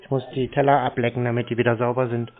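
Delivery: ordinary delivery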